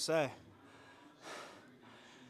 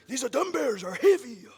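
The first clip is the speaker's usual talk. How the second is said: straining; 11 semitones higher, 11 dB louder than usual. Real, not acted. Straining under dumbbells.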